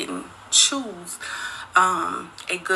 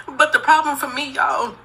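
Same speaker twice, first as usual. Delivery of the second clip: ordinary talk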